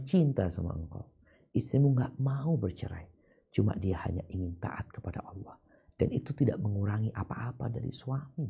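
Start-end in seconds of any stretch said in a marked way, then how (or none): 3.5-5.6 whisper. Real, not acted. Soft advice to husband.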